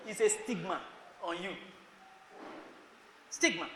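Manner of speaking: normal talking voice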